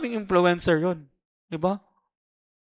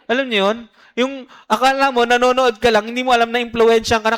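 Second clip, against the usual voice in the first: excited; 5 semitones higher, 5 dB louder, faster than usual. Real, not acted. Excited warning.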